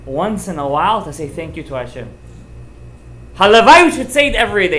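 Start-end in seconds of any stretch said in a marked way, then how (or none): 3.3-4.8 loud exclamation. Real, not acted. Preacher's emphatic exclamation.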